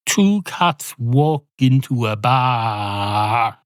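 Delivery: very slow, drawn-out storyteller opening; joke-opening announcement. Acted. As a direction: stretch every word, especially the last. Performs the joke opening after trying.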